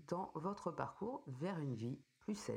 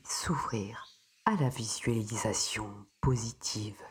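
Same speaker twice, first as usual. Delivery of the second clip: soothing spa whisper; 3 semitones lower, 8 dB louder than usual. Acted. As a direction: ease the listener gently into calm. Guides a breathing meditation.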